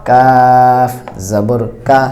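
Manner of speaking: slow কাফ জবর কা with an open, bright a; কা stretched, light and clear; light কাফ recitation. Shown as a demonstration. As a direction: draw out কা like Bengali আকার. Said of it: "দুইটা হরফের উচ্চারণ একই রকম".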